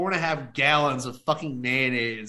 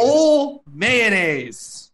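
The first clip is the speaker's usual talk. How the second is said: disgusted; 5 semitones higher, 5 dB louder, much slower than usual. Real, not acted. Disgusted emphasis.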